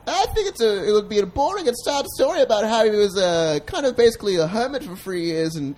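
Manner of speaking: high-pitched persona telling his story; chatty high character voice; high alter ego voice. Pretend. Alter ego narrates [name]'s life.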